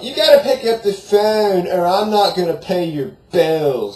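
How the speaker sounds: high, loud, slow shouted threat; mom shouting. Retold or imitated. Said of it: "she shouts back up".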